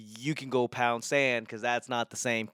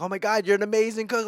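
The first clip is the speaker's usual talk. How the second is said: high, fast gushing praise; imitating a thrilled wife's gushing, higher and quick; 8 semitones higher, 4 dB louder, much faster than usual. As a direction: rave like an overjoyed wife. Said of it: "She's like".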